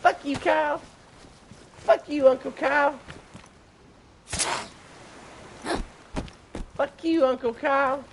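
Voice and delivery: mock little kids jeering their uncle; cartoon kids' voice. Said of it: "they're like".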